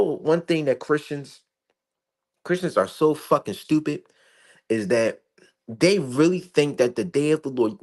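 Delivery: ordinary talk, nothing marked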